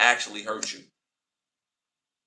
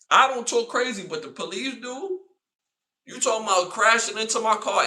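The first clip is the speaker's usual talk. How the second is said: high, loud worked-up retort; heated retort; 9 semitones higher, 3 dB louder than usual. Retold or imitated. Yelling back at the woman in the car. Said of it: "I'm like".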